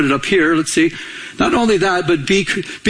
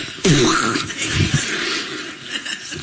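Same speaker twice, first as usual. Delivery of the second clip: breathy laugh; 5 semitones lower, 5 dB quieter than usual. Real, not acted. Remembering mouth washed with soap.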